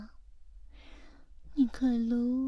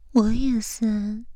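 usual speaking voice

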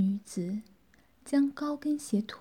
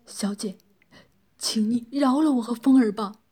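louder, pleading man's voice; hurried begging; 6 dB louder than usual. A narrator's reading. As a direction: beg urgently for mercy. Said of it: "急忙开口求饶道".

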